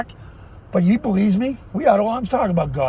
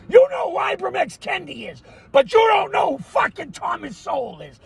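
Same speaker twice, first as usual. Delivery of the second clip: furious shout; 9 semitones higher than usual. Real, not acted. Repeats the question, enraged now.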